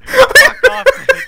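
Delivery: loud, high laughing protest; laughing outburst. Real, not acted. Breaking down laughing at friend's writing, loud laughing. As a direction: burst out laughing mid-read.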